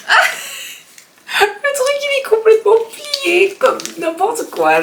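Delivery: high, squeaky voice just after laughing; laughing disbelief. Real, not acted. Vlogger finding the product completely folded up, laughing disbelief. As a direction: squeal it through amused disbelief.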